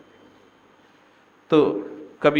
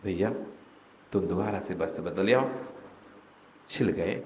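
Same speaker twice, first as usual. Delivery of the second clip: gentle calling; 7 semitones lower, 10 dB quieter than usual. Shown as a demonstration. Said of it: "उसको प्यार से बुलाओ".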